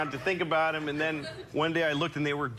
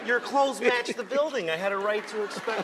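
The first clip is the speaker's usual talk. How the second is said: indignant customer; 5 semitones higher than usual. Retold or imitated. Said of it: "I'm like".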